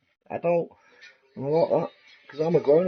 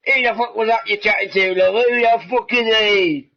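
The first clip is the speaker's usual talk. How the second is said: imitating jealous husband; 7 semitones higher, 5 dB louder than usual.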